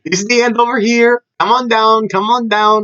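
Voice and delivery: high-pitched, beckoning call; mock announcer. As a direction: call the crowd over, pitched well up. Voicing a film that prolongs its ending.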